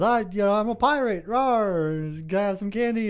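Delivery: a hint of an eager little boy; little kid. Little pirate asks for candy, lightly voiced kid. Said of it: "the little kid says".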